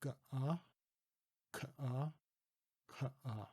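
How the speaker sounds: "Ga-ah, ka-ah, ka-ah" with the vowel drawn apart from the first sound; separated Ga-ah ka-ah. Shown as a demonstration. Teacher demonstrates the separated Dutch sound. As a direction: split the first sound off each syllable. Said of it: "I'll separate the first sound".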